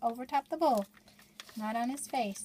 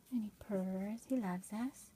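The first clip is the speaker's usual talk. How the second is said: ordinary delivery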